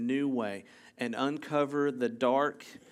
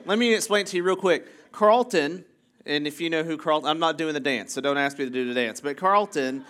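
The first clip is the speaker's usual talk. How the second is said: ordinary talk, nothing marked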